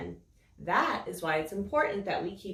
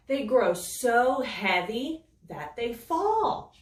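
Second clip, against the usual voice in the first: slow, expressive read-aloud for little ones; storybook reading. A narrator's reading. Teacher reading a rain book to young children, expressive storytelling. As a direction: savour each line like bedtime story.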